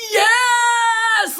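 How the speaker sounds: a high, loud cheer of yes; excited shout. Retold or imitated. Husband reading her text, shouting yes in joy. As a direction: shout it like he just won. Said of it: "He leans back and he's like".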